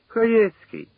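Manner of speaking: high-pitched cry of astonishment; surprised. A narrator's reading. Chernysh spots Khaietskyi rising from the trench, surprised. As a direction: call the name out high, in surprise. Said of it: "здивувався Черниш".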